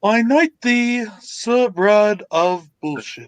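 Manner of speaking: slow, ceremonial British proclamation; drawn-out mock-royal pronouncement; mock knighting. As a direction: knight someone with pompous slowness.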